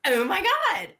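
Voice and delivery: put-on high Valley Girl gush. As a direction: mimic a teen Valley Girl. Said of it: "behind me going".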